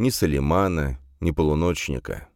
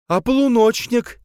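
very high, loud challenge; angry exclamation; 6 dB louder than usual. A narrator's reading. Camille questioning the gendarme about the watchman, angry exclamation. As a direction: demand it crossly, pitch leaping up. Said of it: "сердито воскликнула Камила".

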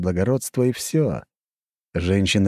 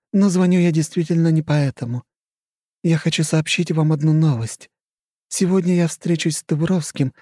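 higher, scheming society lady; coy and calculating, higher; flirty countess; 9 semitones higher than usual. Acted. Khrushcheva's voice continues.

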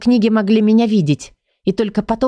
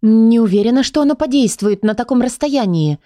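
usual speaking voice

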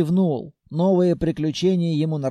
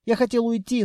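ordinary delivery

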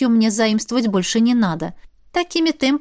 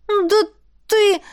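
outraged; 11 semitones higher than usual. Acted. Angry blonde steps forward.

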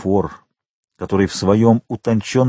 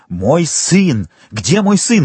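alarmed father demanding his son, pitch climbing; frantic cry; 5 semitones higher, slower than usual. A narrator's reading. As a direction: cry out, higher on the repeat. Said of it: "Этот крик был так страшен".

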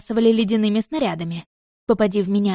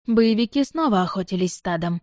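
usual speaking voice

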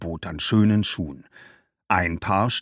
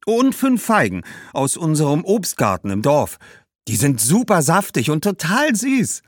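put-on higher, coaxing girl's voice; eager young girl; 5 semitones higher than usual. Girl offering bread and sweet figs, eagerly coaxing. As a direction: talk up your little offer.

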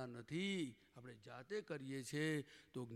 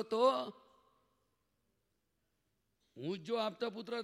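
fervent rise; 5 semitones higher, 5 dB louder than usual. Real, not acted. Preacher building to fervent pitch.

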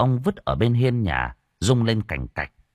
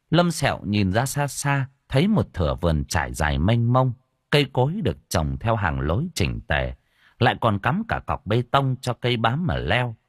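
plain reading voice

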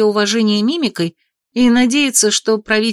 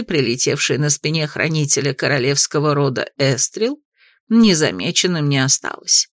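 put-on old woman whispering; 5 semitones lower than usual. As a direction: murmur it low like a secretive granny. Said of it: "прошептала в ответ бабушка".

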